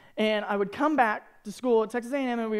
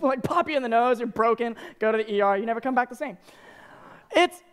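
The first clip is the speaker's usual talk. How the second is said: ordinary delivery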